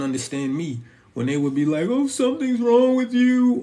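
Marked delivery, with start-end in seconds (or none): 1.9-3.6 high, sneering mock of the other person; mocking dismissive voice. The partner brushing him off, high and mocking. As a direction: toss it off in a much higher mocking tone.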